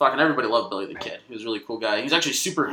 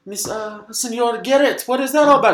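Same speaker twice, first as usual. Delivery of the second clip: high, rising mock bewilderment; hammed-up confused old man; rising from hushed to loud, higher; puzzled old servant; 11 semitones higher, 6 dB louder than usual. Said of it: "the old Mexican man that showed him in is like".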